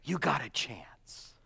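hushed, slow whisper to the congregation; a slow, secret whisper of hope. Real, not acted. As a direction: lean in and whisper it slowly. Preacher drops to an aside.

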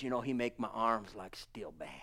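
hushed, breathy retelling of his words; quoted whisper. Retold or imitated. Preacher whispering what a worker told him. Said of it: "but he says".

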